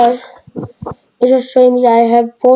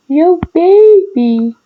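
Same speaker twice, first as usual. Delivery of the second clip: put-on high, slow, sing-song yo baby; a high crooned Yo, baby; high drawn-out greeting; 5 semitones higher, much slower than usual. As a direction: stretch it out high and playful. Greeting car in game.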